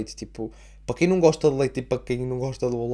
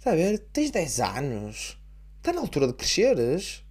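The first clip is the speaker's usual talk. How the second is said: exasperated dad; slower than usual. Pretend. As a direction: tell him to grow up, fed up. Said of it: "isto é um bocadinho o meu medo".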